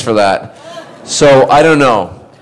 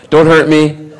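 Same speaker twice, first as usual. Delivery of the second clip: mock timid plea; 5 semitones higher, 4 dB louder, slower than usual. Mock fear of grimy co-star.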